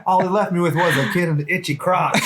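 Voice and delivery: lightly hammed-up hooker complaint; mock hooker voice. Joking voice as the hooker.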